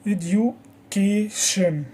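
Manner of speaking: slow eduCAtion, weight on ca; drawn-out teacher's Education, stressed on ca; stress on third syllable. Shown as a demonstration. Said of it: "It is on the third syllable".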